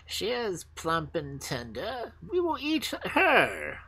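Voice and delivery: slow, menacing robber queen, pitch climbing high; old robber queen. Acted. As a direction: savour each word like a greedy old hag. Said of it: "said the old robber queen".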